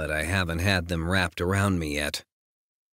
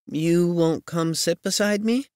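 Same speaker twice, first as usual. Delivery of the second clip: higher, coy invitation; coaxing heroine voice; 12 semitones higher, 3 dB louder than usual. Acted. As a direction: coax him over, pitched up. Heroine coaxes him.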